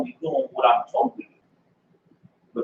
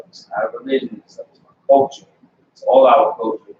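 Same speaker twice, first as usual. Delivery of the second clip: ordinary talk, nothing marked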